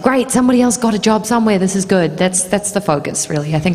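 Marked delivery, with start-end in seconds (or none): 0.0-2.2 cheerful quick reaction, pitched up; mildly upbeat, fast and pleased; be happy for someone else; glad for them. Acted. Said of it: "it's just like".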